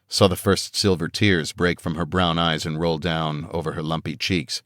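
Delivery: ordinary delivery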